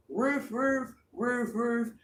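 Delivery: high doubled woofs; imitated dog barks. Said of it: "Even the dogs is out there".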